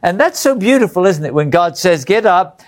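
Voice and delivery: ordinary talk, nothing marked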